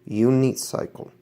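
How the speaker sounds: slow, careful "Unicycle" with no stress on the i; "Unicycle" spelled out slowly for students; teacher models "Unicycle" slowly. Shown as a demonstration. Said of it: "Pronúnciala despacio".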